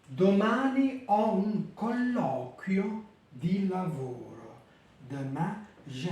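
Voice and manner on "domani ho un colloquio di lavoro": slow careful Italian; slow teacher Italian; Italian sentence read. Shown as a demonstration. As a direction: read Domani ho un colloquio di lavoro clearly. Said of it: "vous avez les mots avec des triphtongues".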